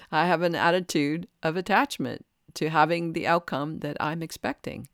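ordinary talk, nothing marked

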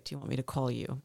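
normal talking voice

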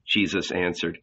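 plain reading voice

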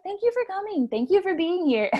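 a hint of a high, sweet hostess welcome; gracious hostess. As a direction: play the polite host greeting guests mid-labor. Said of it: "the hostess mom in labor".

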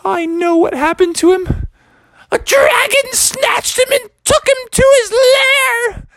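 a mother spinning a scary tale; frantic mom. Acted. Mom explains dragon.

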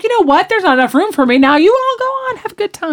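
high sing-song mock of a fake-noble exit; mock martyr Vicky. Retelling Vicky bowing out of the camping trip, high mock martyr voice. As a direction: play the graceful exit like a put-upon saint. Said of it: "She goes like".

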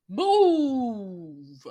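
loud shove-aside bark; shouted move. Retold or imitated. Young witch barking at the crowd, loud shout. Winifred shoving everyone out of her way.

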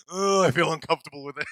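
comic cringing self-quote; mock awkward self. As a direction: play up the discomfort, loud and fast. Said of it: "I was like".

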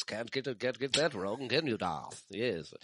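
put-on lightly done British Obi-Wan accent; a hint of Ewan McGregor's British Obi-Wan; Ewan McGregor imitation. Friend imitates Ewan McGregor's British Obi-Wan, light accent. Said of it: "talking like this".

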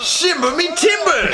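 "Shiver me timbers" in a high hammed-up British voice; British accent. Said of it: "That's my British accent".

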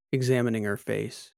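ordinary delivery, nothing marked

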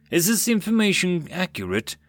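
ordinary delivery, nothing marked

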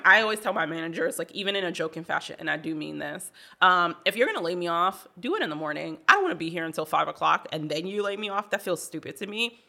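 normal talking voice

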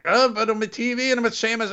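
high, fast hammed-up Scottish brogue; cartoonish Scottish sailor voice; hammy Scottish accent. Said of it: "he's not Popeye and he's not Scottish".

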